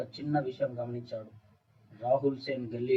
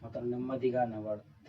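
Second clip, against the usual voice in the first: plain reading voice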